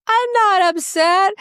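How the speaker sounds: high, slow, baffled protest; incredulous denial. Retold or imitated. Insisting to the boss she's not upset, high and incredulous.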